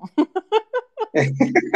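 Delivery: sudden high burst of laughter; a loud, high laugh; bright burst of laughter. Real, not acted. Host laughs at own joke.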